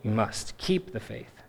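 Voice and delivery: soft, slowing, tender exhortation; gently but firmly. Acted. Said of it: "it gently but firmly".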